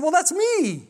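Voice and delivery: high-pitched retold protest; mock indignant. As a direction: pipe up as if personally accused. Retold protest at being called crazy.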